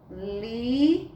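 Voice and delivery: "Lisa" said slowly, the "li" held long; syllable demo. Shown as a demonstration. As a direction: stretch out "Lisa" to show its two syllables. ("Lisa" alongado, sílaba por sílaba)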